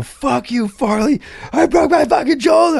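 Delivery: badly faked hurt howl; faked injury wail. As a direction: fake a broken shoulder to fool him. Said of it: "putting it on, like, really acting".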